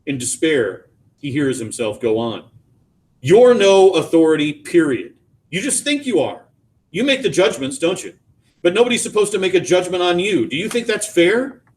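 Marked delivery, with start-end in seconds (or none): 3.2-11.6 raised, accusing voice; angry yelling. A narrator's reading. Father turns on son for judging him, angry. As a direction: start loud and high, press the accusation. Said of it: "what I'm yelling at you for".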